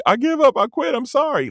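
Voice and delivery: high, pleading imitation; mock surrender. Insulted person giving in to the yelling, mock desperate. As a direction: plead as if caving in.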